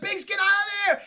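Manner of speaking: high, loud hammed-up yell; urgent warning shout. Luke to Biggs in the dogfight, urgent mock shout. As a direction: yell a warning to your wingman.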